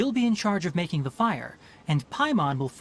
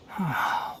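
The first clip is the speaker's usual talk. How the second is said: sigh. Acted. Sighs before musing aloud.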